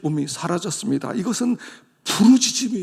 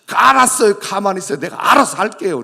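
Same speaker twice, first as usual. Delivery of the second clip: higher, louder snap at a parent; sulky child retort; 4 semitones higher, 8 dB louder than usual. Acted. 부모 말에 대드는 자식이, 높고 큰 퉁명스러운 대꾸. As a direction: brush off mom and dad.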